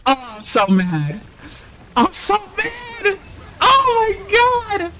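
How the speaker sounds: high, slow, drawn-out whine near tears; frustrated wail. Real, not acted. Streamer wailing to chat after misclicking her hero, half-crying.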